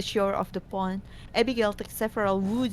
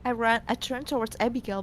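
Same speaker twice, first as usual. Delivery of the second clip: ordinary delivery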